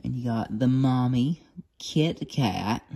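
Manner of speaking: ordinary delivery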